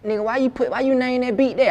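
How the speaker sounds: high, incredulous mock outcry; shocked reaction. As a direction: play the stunned listener. Mocking people's reaction to a beat name.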